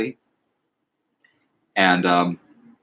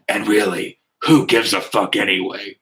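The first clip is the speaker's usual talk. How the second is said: imitating a character cracking up at a bungled line; put-on announcer voice about to break up; Frank. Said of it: "he cracks himself up saying".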